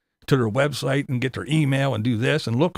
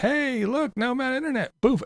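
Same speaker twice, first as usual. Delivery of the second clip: mocking creators; 11 semitones higher than usual. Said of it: "they were like".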